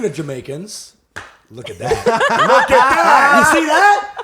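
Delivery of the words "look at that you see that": high, loud laughing exclamations; laughing exclaim. Real, not acted. Hosts greeting a special guest, laughing loudly. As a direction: crack up while pointing it out.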